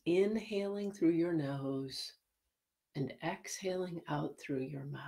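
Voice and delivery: soft and calm, guiding each breath; soft spa whisper. Acted. Guided breathing meditation.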